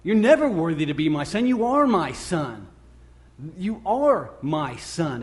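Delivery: acted fatherly insistence, slowing with stress on "are"; emphatic father. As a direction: hammer home "are", drawing the words out. Father cuts son off, insists.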